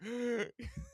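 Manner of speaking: sharp rising mock gasp; shocked gasp. Telling a friend about the store, a sharp shocked gasp. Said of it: "And I was like".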